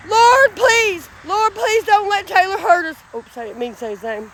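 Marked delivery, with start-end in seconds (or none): 0.1-3.0 re-enacted pleading prayer, raised pitch; fervent prayer. Woman retelling her prayer for protection, fervent pleading. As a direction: call out to the Lord, pleading. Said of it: "I held my hands in the air and said".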